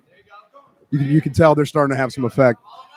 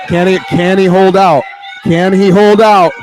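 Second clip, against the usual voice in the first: louder, higher, slow tense call; excited; 5 semitones higher, 5 dB louder, slower than usual. Real, not acted. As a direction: build the suspense on the submission. Tense finish of a submission.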